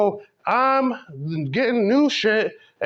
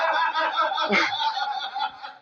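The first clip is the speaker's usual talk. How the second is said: a burst of high laughter at his own joke; real laugh; 8 semitones higher, 4 dB quieter than usual. Real, not acted. Comic laughing at his own joke, real high laugh. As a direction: crack up, high and breathy.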